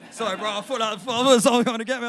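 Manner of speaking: startled. Real, not acted. Poet startled mid-set by a noise, fast and loud. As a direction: blurt it out after a sudden scare. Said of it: "That was mad".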